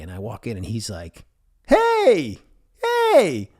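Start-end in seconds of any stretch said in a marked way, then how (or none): 1.7-3.5 high, loud delighted greeting; light up like you haven't seen him in years; excited dad. Retold or imitated.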